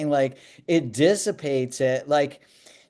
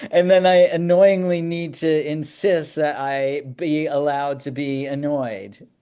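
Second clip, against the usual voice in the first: ordinary talk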